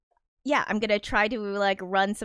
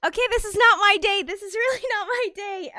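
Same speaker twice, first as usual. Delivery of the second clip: higher, fast, half-laughing exasperation; exasperated; 12 semitones higher, 5 dB louder than usual. Real, not acted. Streamer groaning at bad luck, high exasperated laugh. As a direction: throw your hands up at the day. Exasperated after dropping mouse.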